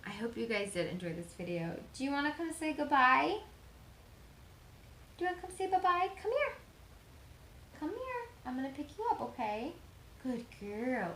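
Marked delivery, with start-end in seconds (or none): 1.9-8.3 much higher, sing-song coaxing voice. Real, not acted. Owner calling her dog over in cooing baby talk. 8.5-11.1 higher, cooing praise for the dog; pet talk. Real, not acted. Picking up her dog.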